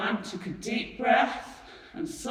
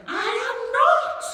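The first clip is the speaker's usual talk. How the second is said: high, loud, drawn-out protest; indignant cry; 7 dB louder, slower than usual. A narrator's reading. Charlie denies to Grandpa Joe that he's joking, crying out. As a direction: cry out in denial, stretching the words. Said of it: "cried Charlie".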